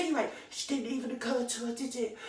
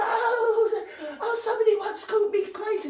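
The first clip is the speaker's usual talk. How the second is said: much higher and louder, simpering with a laugh; mocking girly voice; 11 semitones higher, 7 dB louder than usual. Ranter mocking the podcaster with a high simpering voice. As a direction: send her up as a giggly, simpering girl. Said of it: "And, and she's like".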